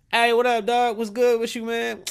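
high, loud buddy greeting; casual greeting voice. Retold or imitated. Vlogger playing a friend greeting him on the street. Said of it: "Fuck somebody up".

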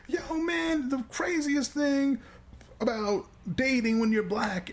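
high, slow hammed-up comic voice; mock comic. Teller mocking a bad comic's set-up in a put-on voice. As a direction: parody a hack stand-up opening.

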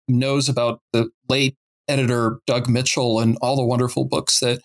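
ordinary talk

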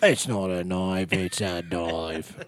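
drawn-out mock Australian accent; badly faked Australian movie hero; Crocodile Dundee. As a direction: muddle a movie quote in fake Australian. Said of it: "I just wanna quote Crocodile Dundee".

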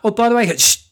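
high, loud mock wife shushing him. Husband retelling his wife shushing him over breakfast. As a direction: play the wife cutting him off mid-thought. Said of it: "her just forgetting and going".

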